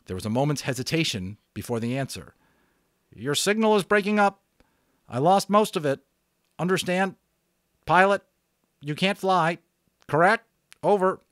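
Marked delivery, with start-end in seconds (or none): 3.1-11.2 higher, louder clipped radio procedure voice; radio operator. Acted. Radio operator telling an unknown caller to release the switch, brisk. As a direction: sound like a pilot on the net.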